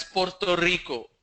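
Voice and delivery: Puerto Rico with crisp rolled r; South American pronunciation. Shown as a demonstration. Teacher showing how South America says Puerto Rico, crisp r. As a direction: sound both r's in Puerto Rico, "porto riko". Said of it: "in South America they pronounce this as".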